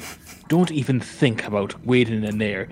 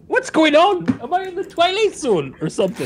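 much higher, mock bewildered outrage; incredulous; 12 semitones higher than usual. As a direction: squeal it in total disbelief. Disbelief at the party.

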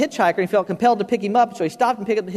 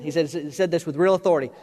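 ordinary talk

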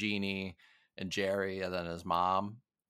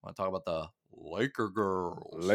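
lightly put-on announcer voice; a hint of a TV host teasing the topic. Podcast host slips into a mock announcer voice. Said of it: "let me do, let me do the voice".

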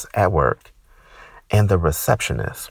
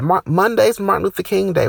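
mock scream; 11 semitones higher than usual. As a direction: scream it like the coworker. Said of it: "she screams that".

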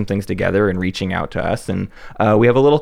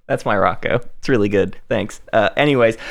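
ordinary delivery

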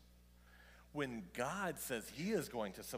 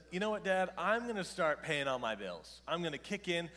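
put-on high, loud boasting kid; lightly voiced son, loud and proud; cocky son; 4 semitones higher, 6 dB louder than usual. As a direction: play the eleven-year-old bragging big plans. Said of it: "What if he just says".

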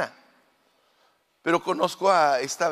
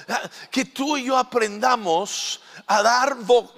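prayer; 3 semitones higher, 3 dB louder, slower than usual. Acted. Prays aloud before the congregation.

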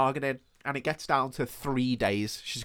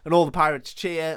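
ordinary talk

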